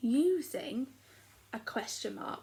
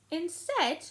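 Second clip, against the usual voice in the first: squeaky, higher, louder full stop; playful exclaim; 11 semitones higher, 6 dB louder than usual. Shown as a demonstration. Teacher to class, showing an exclamation, higher and louder. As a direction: pop it out like an exclamation. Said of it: "because she's shouting".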